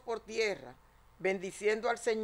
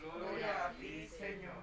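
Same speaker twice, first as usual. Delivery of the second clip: cathedral echo; 11 dB quieter than usual. Real, not acted. Congregation reply heard from afar.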